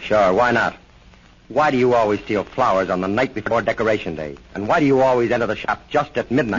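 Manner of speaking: loud, pushing questions; pressing interrogation. Acted. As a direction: lean on the suspect hard. Cop interrogates the prisoner.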